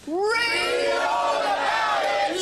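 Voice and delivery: long drawn-out street shout; newspaper seller cry. Shown as a demonstration. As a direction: call it out slowly like a newsboy.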